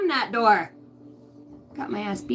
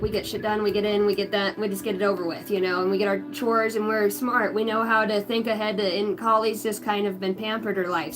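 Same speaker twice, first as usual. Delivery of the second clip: ordinary talk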